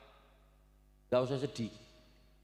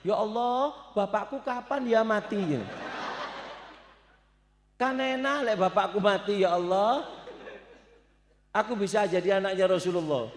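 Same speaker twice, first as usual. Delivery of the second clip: put-on child's voice; 6 semitones higher than usual. Child wishing for father's death.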